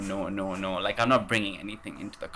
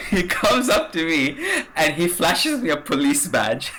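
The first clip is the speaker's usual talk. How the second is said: higher and louder, laughter breaking through; laughing while telling; 7 semitones higher, 6 dB louder than usual. Real, not acted. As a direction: tell it half-laughing at the absurdity. Laughing while telling scary moment.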